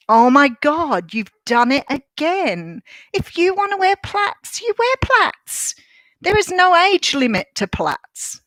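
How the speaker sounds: exasperated inner voice rising high; high-pitched self pep talk. Retold or imitated. Retold self-talk after being upset.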